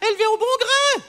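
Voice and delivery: loud, high sales patter; brash dismissal. Acted. Landlady brushing off the tenant's complaint, brash and loud. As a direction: talk her up like livestock at market.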